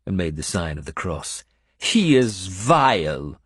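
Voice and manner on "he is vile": slow, fierce and bitter. Acted. Benedetta condemning Wayamund to Uhtred, fierce. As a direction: spit it out slowly, full of loathing. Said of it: "she spoke so fiercely".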